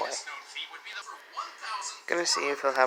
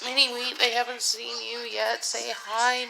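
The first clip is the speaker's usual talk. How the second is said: high pet-talk voice; 8 semitones higher than usual. Real, not acted. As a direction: sweet-talk the cat up high. Talking to the cat.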